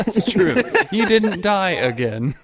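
high, loud, laughing tease; laughing mock echo. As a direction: laugh through the words.

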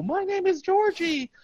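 put-on high child voice; kid voice. Retelling the movie, Georgie in a high child voice. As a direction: sound like little Georgie.

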